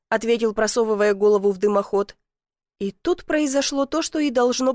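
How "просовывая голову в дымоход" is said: ordinary delivery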